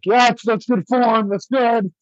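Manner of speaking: high-pitched pretend cheerleading from the sidelines; mock encouragement. Awkward bystander pretending to coach Mike, mock encouragement. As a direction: put on a high, over-keen coaching voice. Imagined bystander praising during the fight.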